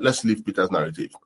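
normal talking voice

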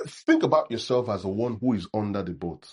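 ordinary talk

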